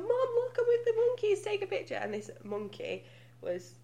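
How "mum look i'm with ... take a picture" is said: put-on higher-pitched child calling to mum; lightly voiced kid showing off to mum; excited child.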